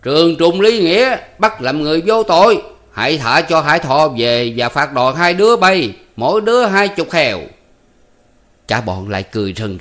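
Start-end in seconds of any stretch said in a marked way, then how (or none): 0.0-7.4 loud, higher stage shout; louder theatrical yell, slowing mid-way. Acted. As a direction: yell the judgment at the guards. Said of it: "hét".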